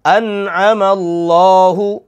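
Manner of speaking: very slow chanted verse; slow Quranic recitation. Shown as a demonstration. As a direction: recite melodically, letting words ring. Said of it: "आइन".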